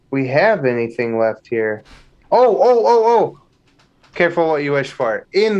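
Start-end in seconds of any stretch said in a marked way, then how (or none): 2.3-3.4 high, loud urgent burst of ohs; excited interjection. Real, not acted. Host suddenly remembering a theory to share, excited interjection. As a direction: jump in eagerly, pitched high and loud. Eager to jump in with idea.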